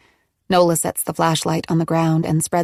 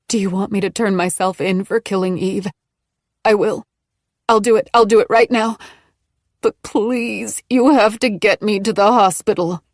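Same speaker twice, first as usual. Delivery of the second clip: rising, louder, building plea; desperate pleading; 3 semitones higher, 4 dB louder than usual. Acted. Maggie in labour begs Nola to take her to hospital. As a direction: beg harder each line.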